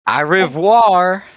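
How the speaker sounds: loud, slow, stretched mock French au revoir. Friend mocking French to friend, hammed-up slow au revoir. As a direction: ham up a French farewell.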